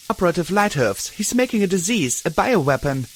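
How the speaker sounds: shouting back. A narrator's reading. Glory shouting back at her sister. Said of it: "Glory shouted back".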